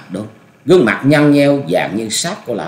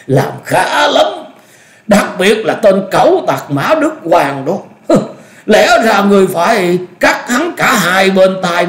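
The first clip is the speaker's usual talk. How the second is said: put-on high, loud vengeful old master; wildly excited gloating; 9 semitones higher, 4 dB louder than usual. A narrator's reading. Old master praising the maiming of his enemy, elated. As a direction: gloat over an enemy's injury. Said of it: "Khuất Tịch lại phấn khích tột độ".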